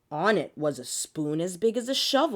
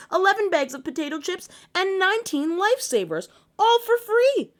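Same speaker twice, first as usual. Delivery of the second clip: faster and higher to a loud peak; building excitement; 12 semitones higher, 5 dB louder than usual. A narrator's reading. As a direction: pile it on gleefully. Building list climax.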